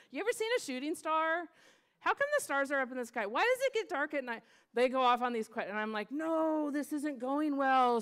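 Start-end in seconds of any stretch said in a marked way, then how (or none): 0.1-4.4 higher-pitched, lightly voiced eager questions; curious kids. Kids firing off distracted questions. 6.1-8.0 higher, louder, drawn-out wail of frustration; mock dismay. Leader's inner dismay as lesson derails.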